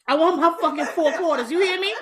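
loud, half-laughing demand; mock demand. Acted demand for her dollar back.

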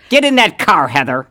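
put-on high, loud yell in upstate accent; mock angry yell. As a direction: bellow a hammed-up order at your partner. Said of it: "you yell at me".

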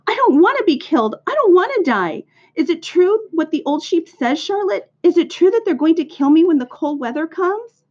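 high, loud panicked pleading; acted squealing little pig, desperate for an answer; frantic screaming. A narrator's reading. Pig asks Charlotte if he will be killed, screamed. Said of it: "screamed Wilbur".

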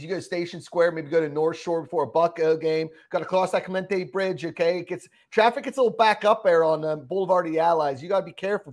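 hammed-up Pittsburgh local twang; thick Pittsburgh accent. Shown as a demonstration. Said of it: "terrible Pittsburgh accent".

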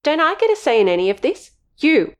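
high, heated accusation; accusing. Acted. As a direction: snap at the intruder, rising. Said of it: "pointed accusingly".

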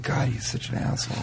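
whispered audience mutter; whispered heckle. Retold or imitated. Comic retelling a crowd member's whisper, unvoiced. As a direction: breathe it like someone in the crowd. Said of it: "heard people in the audience say".

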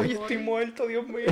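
high-pitched real laughter, dying of laughs; laughing. Real, not acted. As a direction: crack up helplessly high. Cracking up at friend's story.

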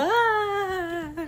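high bye that falls away; falling away bye. Shown as a demonstration. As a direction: say bye like jumping off. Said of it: "How did you do the jump?".

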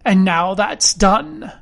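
a hint of cruel finality; slow, cold close to the business; lightly voiced cold beggar. A narrator's reading. Blind man finishes with the captain, mildly cold and cruel. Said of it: "a voice so cruel and cold and ugly".

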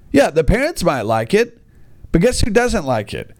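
normal talking voice

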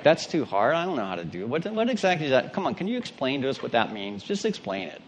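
whiny excuses. Retold or imitated. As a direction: mimic a reluctant believer muttering excuses.

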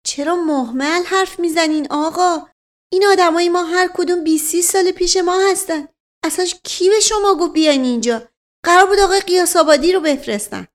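high, fast angry scolding; indignant lady. Acted. Lady of the house scolding the officer, furious. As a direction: berate the officer. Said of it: "برای اولین بار برآشفت".

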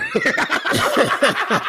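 loud high laugh. Real, not acted. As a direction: burst out laughing.

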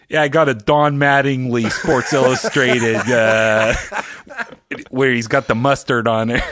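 mock Pittsburgh pawn-shop owner on the phone; imitating Pittsburgh guy. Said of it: "He's like".

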